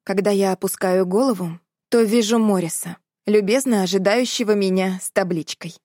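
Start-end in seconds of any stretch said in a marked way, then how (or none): none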